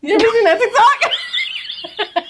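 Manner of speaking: high laughing question. Real, not acted. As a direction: laugh through it. Laughing about TikTok.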